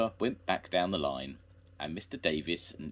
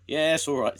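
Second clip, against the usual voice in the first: loud; 9 semitones higher, 10 dB louder than usual. A narrator's reading. Jones quieting noisy sheep.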